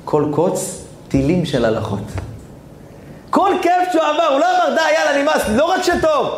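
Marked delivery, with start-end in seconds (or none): 3.3-6.3 preaching outburst. Real, not acted.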